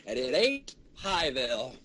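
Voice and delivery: ordinary talk, nothing marked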